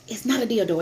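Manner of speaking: normal talking voice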